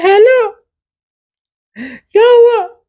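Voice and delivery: high, loud, startled phone answer; high nervous yelp answering mom; panicked hello. Retold or imitated. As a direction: squeak it out in a fright. Answering mom's call in panic.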